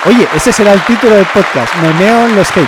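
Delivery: high loud exclaiming; excited. Real, not acted. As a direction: shout it out gleefully. Excited title idea.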